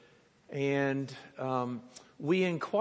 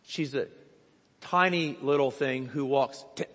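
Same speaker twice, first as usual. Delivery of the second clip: ordinary talk, nothing marked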